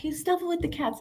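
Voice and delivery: high, soft mock Ashley voice; a light high imitation of a friend teasing her; quoting Ashley. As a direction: lift way up and soften to play Ashley. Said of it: "Ashley's like".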